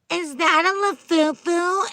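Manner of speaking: high, drawn-out mocking question; put-on snotty squeal, slowed down; snotty girl. Snotty girls judging a fake Labubu, high and mocking. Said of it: "snotty girls being like".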